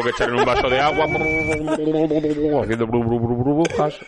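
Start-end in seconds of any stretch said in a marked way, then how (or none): 3.0-4.0 burble like something dropped in water; playful bubble sound effect; bubbling noise. Shown as a demonstration. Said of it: "Qué bien te sale el sonido de".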